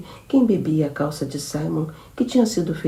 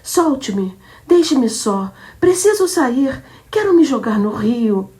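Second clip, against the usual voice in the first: higher and louder, desperate pleas; frantic struggling; 8 semitones higher, 8 dB louder, slower than usual. Acted. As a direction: fight to break free. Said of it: "começou a lutar novamente". (súplica frenética e alta)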